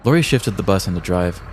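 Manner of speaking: ordinary delivery, nothing marked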